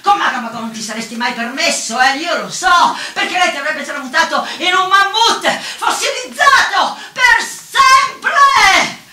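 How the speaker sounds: high, loud tirade building to a scream; sarcastic shouting. Retold or imitated. Woman yelling at the trickster as he leaves, furious shouting. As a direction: shout after him, peaking on the final words. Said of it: "gli gridai".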